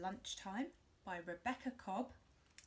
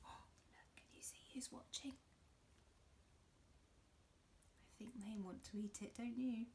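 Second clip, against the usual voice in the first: whisper; 9 dB quieter than usual. Real, not acted. Secretive aside to children.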